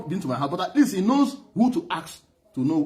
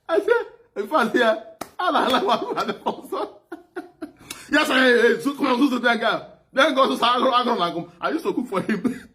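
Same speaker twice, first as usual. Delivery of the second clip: can barely get the words out for laughing; laughing hard; 7 semitones higher than usual. Real, not acted. Stammering through laughter while telling the friends about the leader. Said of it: "when I laugh hard like this".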